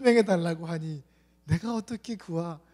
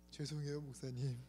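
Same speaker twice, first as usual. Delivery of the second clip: meek apology; 4 semitones lower, 13 dB quieter than usual. Retold or imitated. Young man apologises after scolding.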